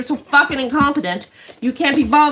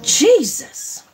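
exasperated; 6 semitones higher than usual. Real, not acted. Exasperated outburst mid rant.

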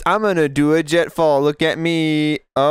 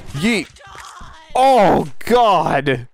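normal talking voice

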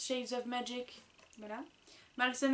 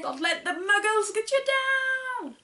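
higher, louder, hammed-up quote; mock Ron Weasley; 10 semitones higher, 8 dB louder than usual. Sister reading the notebook quote as Ron Weasley, hammed up. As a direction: belt out the Ron Weasley line. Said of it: "Ron Weasley".

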